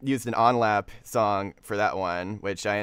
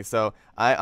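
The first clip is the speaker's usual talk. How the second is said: ordinary delivery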